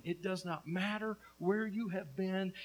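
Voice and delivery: ordinary talk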